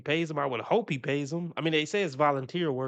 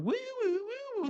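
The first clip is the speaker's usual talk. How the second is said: high falsetto mocking woos; hammed-up mock woo-ing, high and showy; performative woo; 5 dB quieter than usual. Mocking the cart narc's showy woo, high falsetto. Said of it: "he does all that performative".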